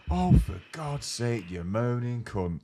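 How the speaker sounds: slow, loud drawn-out exasperated groan; mock boss losing patience. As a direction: drag it out, fed up. Director's exasperated insult acted.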